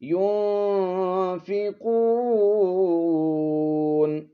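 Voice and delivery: युनफिकून with the nun hidden in the nose, long and drawn; very slow chanted ikhfa, nasal nun. Shown as a demonstration. Teacher shows students the hidden nasal nun, very slowly. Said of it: "इस तरह की आवाज़ निकालेंगे आप".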